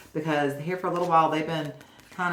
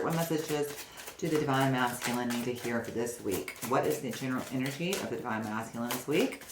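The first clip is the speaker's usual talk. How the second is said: invocation; 3 semitones lower, 4 dB quieter than usual. Acted. Invocation while shuffling.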